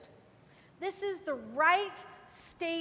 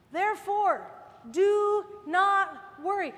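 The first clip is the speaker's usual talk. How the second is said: louder, ringing therefore do not worry; emphatic proclamation; 2 semitones higher, 5 dB louder, slower than usual. Acted. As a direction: declare it to the congregation. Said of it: "preaching emphasis".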